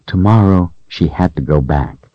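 ordinary delivery, nothing marked

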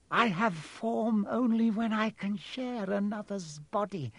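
high, eerie villain, swelling and fading; Voldemort's voice. A narrator's reading. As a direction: menace the boy in a high, deliberate voice. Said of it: "the face said".

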